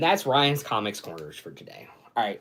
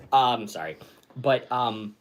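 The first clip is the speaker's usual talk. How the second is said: ordinary delivery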